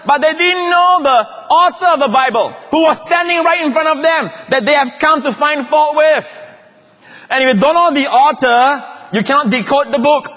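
high, loud preaching, pressing the point home; full-force preaching. Acted. Preacher telling the congregation at full force. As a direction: preach it out to the whole church.

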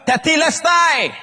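high, drawn-out shout; loud final cry. Acted. Preacher giving the Greek cry of Jesus, shouting. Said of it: "He cried with a loud voice".

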